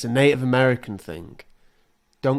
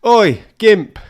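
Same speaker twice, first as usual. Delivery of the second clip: loud, high yell across the street; shouted heckle; 8 semitones higher, 11 dB louder than usual. Retold or imitated. Stranger yelling at him outside his building, loud mock heckle. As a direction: bark it out like a rude stranger. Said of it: "you heard a voice go".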